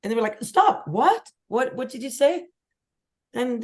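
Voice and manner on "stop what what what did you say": startled double take. Retold or imitated. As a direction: stop them in their tracks. News crew reacting in surprise.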